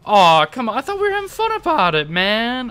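high, aggrieved complaint; whining protest. Real, not acted. Player complaining to rival who spoiled the fun, whining protest. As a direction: push it up high, feeling cheated.

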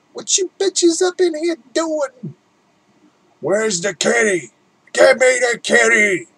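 high, loud, rowdy puppet alien voice; Alf impression. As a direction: squeal it like a crude alien puppet. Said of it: "I can't do an Alf impression".

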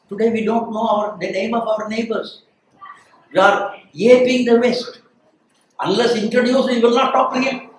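normal talking voice

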